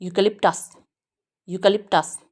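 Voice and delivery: यूकेलिप्टस with stress on the कैं syllable; eucalyptus model word. Shown as a demonstration. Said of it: "कैंडी stress है".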